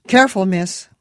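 high, loud Eastern European cabbie voice; thick Moldovan accent, chatty and friendly. A narrator's reading. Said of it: "This one had an accent".

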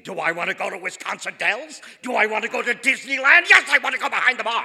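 a kid who can barely contain how badly he wants to go; child bursting with excitement, building to a rushed yes; inner excitement. Kid's inner thrill at the offer.

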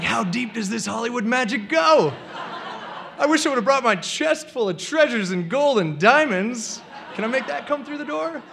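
giddy amazement, laughing; laughing disbelief. Retold or imitated. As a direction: marvel out loud, laughing. Amazed at free cough drops.

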